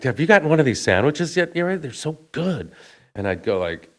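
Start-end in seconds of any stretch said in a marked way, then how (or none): 0.0-2.7 lightly voiced sweet co-star, higher and fast; co-star urging him to eat, high and bright; imitating Tara Reid. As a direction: coax him to eat, sing-song. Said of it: "she kept going, like".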